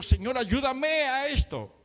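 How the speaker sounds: pleading prayer. Retold or imitated. Quotes own plea to God.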